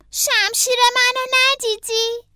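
high, slow, drawn-out question; child hero's voice. A narrator's reading. Franklin asks his mother about his lost sword, high child voice. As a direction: ask in a high-pitched child's voice.

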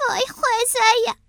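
high-pitched character exclamation; rabbit's dismay. A narrator's reading. Howard feeling sick after the cake, high dismayed voice.

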